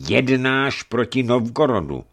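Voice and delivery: high, loud, building indignation; angry accusation. A narrator's reading. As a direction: accuse him wrathfully, phrase by phrase. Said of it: "začal hněvivě Eufemii".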